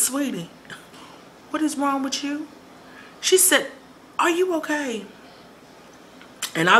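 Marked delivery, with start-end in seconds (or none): 0.1-5.1 lightly voiced worried coworker; concerned coworker. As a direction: sound worried for a friend.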